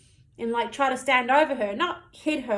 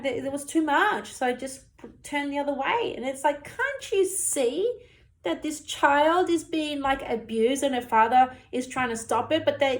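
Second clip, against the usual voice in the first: ordinary talk, nothing marked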